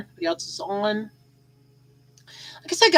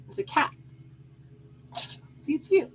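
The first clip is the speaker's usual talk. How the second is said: cooing to pet; 8 semitones higher, 6 dB quieter than usual. Real, not acted. Cooing to cat at mirror.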